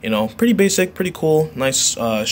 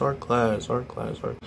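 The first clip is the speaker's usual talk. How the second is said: fading echo, softer each time; mimicked echo; 7 dB quieter than usual. Shown as a demonstration. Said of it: "can't edit in an auditory echo".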